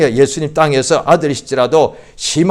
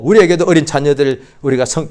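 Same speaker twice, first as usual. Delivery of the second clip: ordinary delivery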